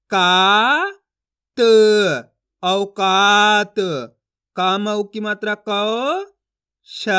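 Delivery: slow chant, single syllables held long and loud; syllable reading drill. Shown as a demonstration. Teacher modelling word reading for learners, drawn out and emphatic. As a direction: stretch each sound apart, then blend it evenly. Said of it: "इन अक्षरों का उच्चारण कर रहा हूँ".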